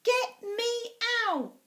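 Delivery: put-on very high, loud child's yell; shouting. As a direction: scream to be let out of the seat. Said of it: "in the end I shouted".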